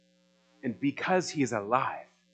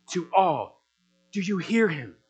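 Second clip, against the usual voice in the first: ordinary talk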